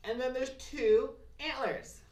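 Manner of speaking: high and loud, delighted to show the pair; a bright show-and-tell moment; excited reveal. Real, not acted. Craft teacher showing kids the antlers, excited.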